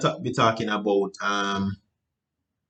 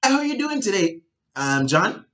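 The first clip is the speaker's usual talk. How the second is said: much higher, bright salesy greeting; too excited greeting; 8 semitones higher than usual. Shown as a demonstration. Salesperson greeting John, wrong way, overexcited. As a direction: pitch it way up at John. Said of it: "if you were up here".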